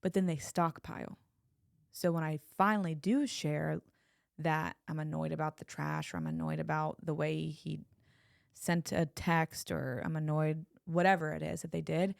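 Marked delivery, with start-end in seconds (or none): none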